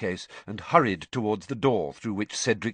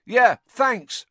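louder, tossed-back call; shouted over shoulder; 12 semitones higher, 9 dB louder than usual. A narrator's reading. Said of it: "Ron shouted over his shoulder".

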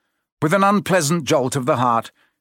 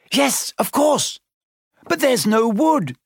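high, panicky wail; distressed cry; 11 semitones higher than usual. A narrator's reading. Hermione panicking over making fire, crying out. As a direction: cry out helplessly, pitched high. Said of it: "Hermione cried, wringing her hands".

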